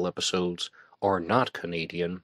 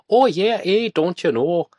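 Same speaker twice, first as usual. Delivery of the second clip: put-on loud, high-pitched caricature of a Canadian accent; hammed-up Fargo-style fake Canadian accent; mock Canadian accent; 11 semitones higher, 8 dB louder than usual. Mimicking Americans trying to sound Canadian, exaggerated accent. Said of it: "they try to do a Canadian accent".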